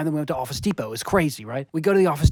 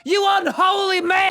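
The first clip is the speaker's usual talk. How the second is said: high, loud, anguished ranting; melodramatic shouting; 14 semitones higher, 3 dB louder than usual. Acted. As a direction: wail it like a tormented soul. Melodramatic outburst.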